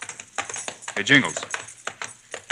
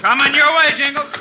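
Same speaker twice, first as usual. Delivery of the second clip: put-on brash old-fashioned hero bravado; 14 semitones higher than usual. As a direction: yell it out from the saddle. Hero riding the wild horse.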